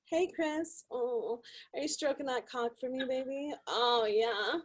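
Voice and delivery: soft, breathy come-on; phone sex voice. Shown as a demonstration. Operator flirting with a caller on the line, soft seductive voice. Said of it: "Not with this voice. Uh, normally I'm like".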